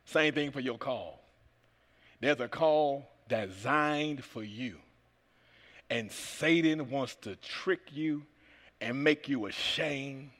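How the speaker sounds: slow, low, soft preaching; slow down and soften; quiet and slow. Acted.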